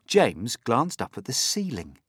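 ordinary delivery

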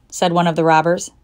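usual speaking voice

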